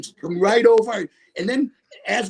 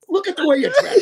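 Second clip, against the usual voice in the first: laughing tease; 11 semitones higher, 5 dB louder than usual. Retold or imitated. Friend mocking his outfit, laughing.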